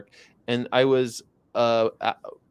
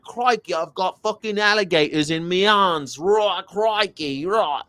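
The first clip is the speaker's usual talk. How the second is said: higher, a lightly Australian crikey voice; mock Australian wildlife host; Steve Irwin impression; 6 semitones higher than usual. Mocking a Steve Irwin picture, lightly Australian. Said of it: "It's a picture of him like".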